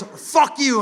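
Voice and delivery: high-pitched exasperated snap; mock angry curse. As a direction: imitate a Frenchman losing it. Acted quarrel, Frenchman curses.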